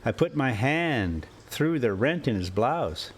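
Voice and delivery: usual speaking voice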